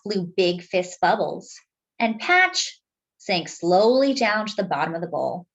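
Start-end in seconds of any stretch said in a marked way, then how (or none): none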